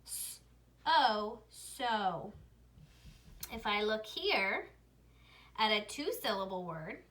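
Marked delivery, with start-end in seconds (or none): 0.1-2.3 "S" as a hiss, long "O", then "so"; sounding out. Shown as a demonstration. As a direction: sound out "S, O, so" with pauses. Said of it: "make its long sound O".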